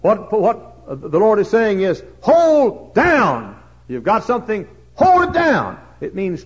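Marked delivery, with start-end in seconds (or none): 2.2-3.6 emphatic command. Acted. Preacher stressing command. 4.9-5.8 emphatic shout. Acted. Preacher stressing command.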